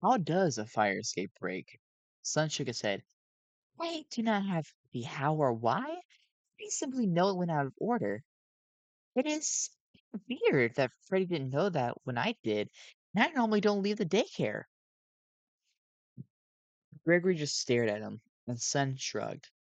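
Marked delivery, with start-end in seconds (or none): 3.8-14.6 high, nervous animatronic voice; gentle worried robot voice; Sun voice. A narrator's reading.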